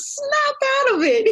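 very high, sharp mock scolding; Cher impression. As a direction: snap at him like Cher in the film. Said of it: "she gives him a slap and says".

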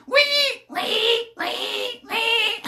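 squealing "wee" like a little pig, the first one high; mock pig squeals. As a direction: squeak out each "wee" as a piggy, laughing at him. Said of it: "Just imagine him going".

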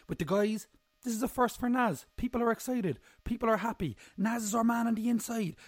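high, loud, over-the-top hype; excited hype voice. Pretend. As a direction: pump it up like a hype man. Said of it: "I'm getting a bit caught up in the voice".

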